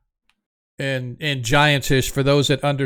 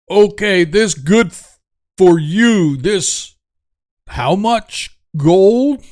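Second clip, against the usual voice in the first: slow, loud giant voice, pitched up; puzzled giant; 4 semitones higher, 6 dB louder, slower than usual. Acted. Giant scratching his head over the deal, loud puzzled voice. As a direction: ask like a dim, bargaining giant.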